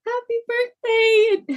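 high, slow, sing-song happy birthday; sweet baby greeting. Retold or imitated. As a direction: coo it like greeting a newborn. Staff greeting her newborn.

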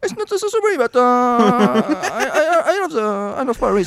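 high, halting English with a French accent; poor French-accented English. Shown as a demonstration. Aspiring director pushed to speak English, halting French accent. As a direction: stumble through English in a French accent, drawing out words. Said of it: "I don't speak very, very good, uh, English".

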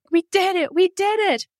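giddy high-pitched we-made-it cheer; triumphant cheer. Retold or imitated. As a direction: celebrate making it through the wind. Triumphant arrival at hotel.

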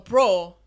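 "bro" drawn out with a full O; demonstrating the long, deep "bro" of Hialeah. Shown as a demonstration. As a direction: say "bro" slowly, Hialeah style. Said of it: "Like, the deep bros are Hialeah".

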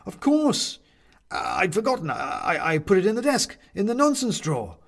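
high, loud, stammering recollection; flustered realisation. A narrator's reading. Benedict suddenly remembers the desk drawer, flustered and excited. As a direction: burst out as the memory returns.

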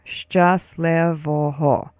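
"Szczęśliwego" very slow, shchus-leh-vo-ho; slow word demo. Shown as a demonstration. Teacher has listeners join in, "Szczęśliwego" slow as shchus-leh-vo-ho. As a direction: lead a repeat of "Szczęśliwego" syllable by syllable. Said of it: "Say it with me once more".

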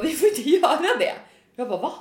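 ordinary talk

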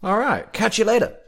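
breezy surfer dude. Acted. GM voicing surfer NPC.

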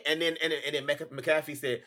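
ordinary delivery

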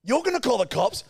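high-pitched retold comeback; incredulous retort. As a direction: throw it back in acted disbelief.

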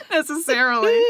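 word squeezed out through a high laugh; can't stop giggling while talking. Real, not acted. Cohost breaking up laughing over the gator prank. Cracks up mid-sentence.

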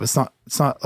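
ordinary talk

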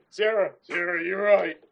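loud, booming barbarian. Acted. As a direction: bellow it like a brute warrior. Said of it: "general barbarian voice".

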